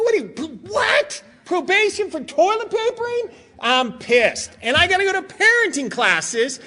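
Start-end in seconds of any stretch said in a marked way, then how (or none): 0.0-3.3 high pitched cry of disbelief; outraged disbelief. Retold or imitated. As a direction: shriek it like you can't believe your ears. Outraged dad hearing of probation.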